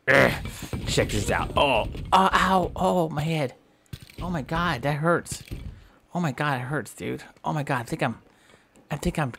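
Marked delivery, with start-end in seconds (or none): 1.6-5.4 high mock cries of pain; hammed-up pain after a hit. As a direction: yelp high like you got hurt. Character hurt by building.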